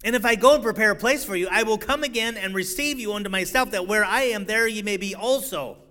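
loud, soaring scripture preaching. Acted. Preacher proclaiming Jesus' promise to his congregation, loud and soaring. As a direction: proclaim the promise to the whole church.

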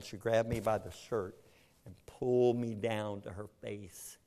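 usual speaking voice

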